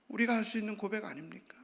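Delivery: whispered question; whispered rhetorical question. Real, not acted. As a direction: ask it in a hush.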